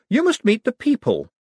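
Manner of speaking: high, loud coaxing voice. A narrator's reading. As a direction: coax him outward grandly. Moist coaxing.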